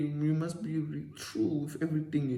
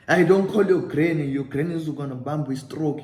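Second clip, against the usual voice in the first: louder, higher sharp refusal; mock stern warning; 3 semitones higher, 9 dB louder than usual. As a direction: cut him off firmly. Scammer snapping back at narrator.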